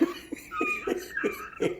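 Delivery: high squealing giggle. Real, not acted. Laughing at themselves.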